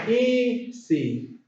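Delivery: EASY in two drawn-out beats, high then falling; split into syllables. Shown as a demonstration. Teacher showing two syllables, EASY slowly split. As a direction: separate EASY with a gap after the first syllable. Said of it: "Two syllables".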